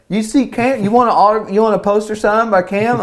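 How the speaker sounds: high, loud, eager dad voice; proud dad imitation. Dad to kids in the surf shop, eagerly boastful. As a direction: imitate a dad pitching his son to strangers.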